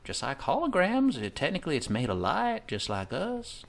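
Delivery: normal talking voice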